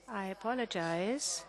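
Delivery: ordinary delivery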